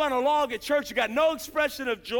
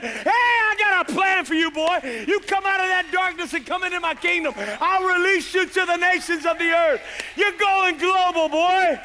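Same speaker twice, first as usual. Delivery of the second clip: loud, high commanding call; voice of God calling; 6 semitones higher, 6 dB louder than usual. Retold or imitated. God calling the sinner out of darkness, loud and high. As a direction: boom it out like God's summons. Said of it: "and said".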